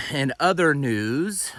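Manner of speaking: slow, loud news anchor intro; newscaster voice. Shown as a demonstration. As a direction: announce it like a TV anchor. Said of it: "sound like a newscaster".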